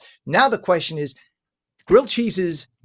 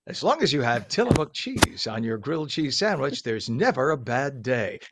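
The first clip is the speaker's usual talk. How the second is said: commercial voice; 3 semitones lower than usual. Pretend. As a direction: sell it like a TV cheese commercial. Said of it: "guys, you gotta hire me".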